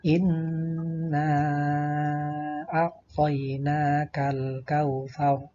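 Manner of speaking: slow, sustained recitation with long held vowels; Qur'an recitation. Shown as a demonstration. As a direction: recite it slowly as a model for pupils. Contoh bacaan ayat. (tilawah pelan dengan bacaan panjang)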